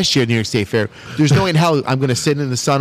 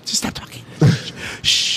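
softer, whispered shushing; hushing whisper; 3 dB quieter than usual. Pretend. Friend playing out the threesome, whispered hushing. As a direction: whisper it to shut someone up.

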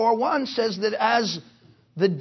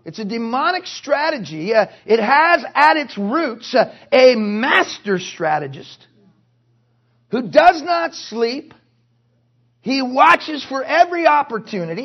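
forceful preaching; 5 dB louder than usual. Real, not acted. Preaches forcefully about the devil.

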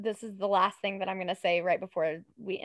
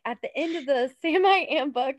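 ordinary talk, nothing marked

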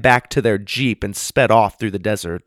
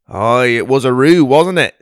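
put-on Australian accent; 3 semitones higher, 8 dB louder than usual. Aussie bushman greeting the kids in the pond, hearty Australian accent. Gundy speaks.

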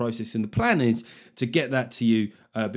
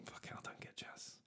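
whisper; 28 dB quieter than usual. Acted. Whispered aside after jazz intro.

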